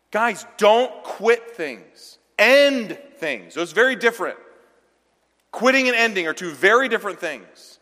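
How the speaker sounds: preacher exhorting the men not to quit, forceful; preaching at full force. Acted. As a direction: pound it home to the men.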